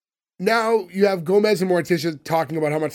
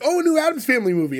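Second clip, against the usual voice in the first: higher-pitched, quick mock reaction; 8 semitones higher, faster than usual. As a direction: toss off a quick, higher imagined moviegoer's reaction. Voicing a reaction to new movies.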